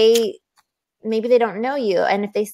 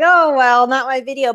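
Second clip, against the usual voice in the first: disappointed, higher and louder; bummed; 5 semitones higher, 4 dB louder than usual. Retold or imitated. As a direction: let the letdown show. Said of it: "so bummed".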